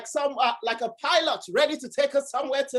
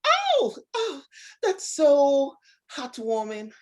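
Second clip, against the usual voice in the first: posh voice; 2 semitones higher, slower than usual. Pretend. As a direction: put on a posh gushing tone. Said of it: "so what's the posh one".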